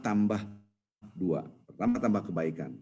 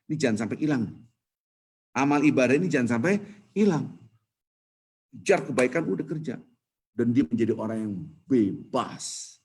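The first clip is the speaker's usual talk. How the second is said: ordinary delivery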